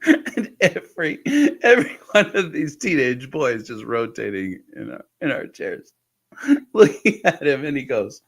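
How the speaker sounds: words squeezed high through laughter; laughing. Real, not acted. Son retelling dad's blunder, laughing through words. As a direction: tell it while cracking up. Laughing while telling the scene.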